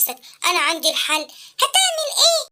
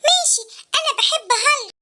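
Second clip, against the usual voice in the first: ordinary talk, nothing marked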